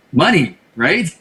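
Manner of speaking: ordinary talk, nothing marked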